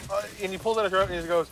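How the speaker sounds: ordinary delivery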